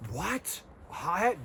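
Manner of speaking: high, incredulous "What? Hothead?"; feigned disbelief at himself; mock surprise. Mock shock at own label.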